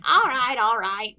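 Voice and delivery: loud, exasperated surrender; exasperated giving in. Acted. Jailer yielding to a legal threat, exasperated. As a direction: give in loudly to stop the lecture.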